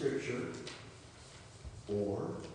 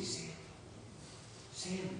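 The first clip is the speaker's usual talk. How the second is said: hushed calling of a name; soft mysterious call; 4 semitones higher, 13 dB quieter than usual. Acted. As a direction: call the name very softly, as a voice in the night. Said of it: "he heard this voice say".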